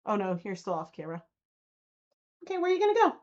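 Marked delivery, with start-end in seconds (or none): 2.4-3.1 higher coaxing voice to the dog; pet talk. Real, not acted. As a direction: coax the dog back into frame.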